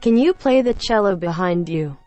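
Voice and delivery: normal talking voice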